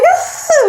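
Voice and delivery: high-pitched scream of shock; re-enacted scream. Two friends in a car looking at each other, screaming. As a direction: shriek it, re-enacting the panic.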